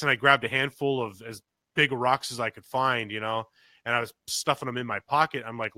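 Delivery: normal talking voice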